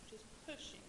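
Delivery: breathless whisper, pushing with all her strength; barely voiced, straining against something huge; strained whisper. Pushing alone at the bottom of the iceberg, whispered strain.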